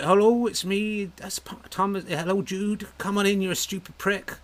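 mock West Country aunt with a broad Dorset burr; Dorset accent. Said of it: "She'd be like".

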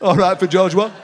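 lightly voiced workmate pushing barrows; imitating Alan. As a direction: ham up the workmate's catchphrase. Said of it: "he would go".

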